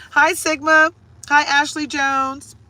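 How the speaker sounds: high, loud hello; cheery greeting. Real, not acted. Greeting arriving viewers by name, high cheery call. Greeting people who come in.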